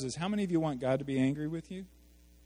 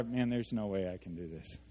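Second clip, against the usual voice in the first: low and soft, giving up; quiet despairing mutter; defeated; 6 semitones lower, 10 dB quieter than usual. Shown as a demonstration. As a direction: sigh it out like it's hopeless.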